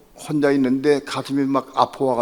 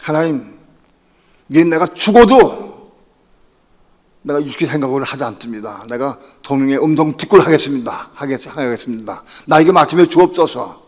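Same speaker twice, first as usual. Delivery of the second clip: fervent prayer; 3 semitones higher, 7 dB louder than usual. Retold or imitated. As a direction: cry out to God in earnest. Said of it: "물었어요". (간절히 부르짖는 기도)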